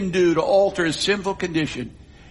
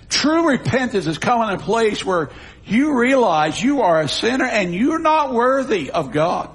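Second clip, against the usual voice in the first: normal talking voice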